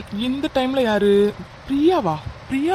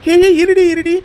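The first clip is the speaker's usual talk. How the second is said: loud, high-pitched protest; calling out urgently; 8 semitones higher, 7 dB louder than usual. Acted. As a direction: shout to stop her hanging up. Shouts as she hangs up. (உரக்கப் பதற்றத்துடன்)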